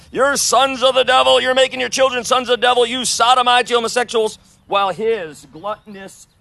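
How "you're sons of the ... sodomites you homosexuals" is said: high, loud mocking street-preacher yell; imitating a yelling preacher. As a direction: scream it like a ranting street preacher. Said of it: "All you're doing is yelling about homosexuality".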